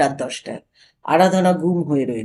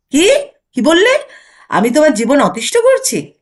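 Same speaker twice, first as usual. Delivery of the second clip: much louder, higher indignant cry; outraged; 6 semitones higher, 5 dB louder than usual. Acted. Wife outraged at husband's jab, indignant. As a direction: bristle with wounded fury.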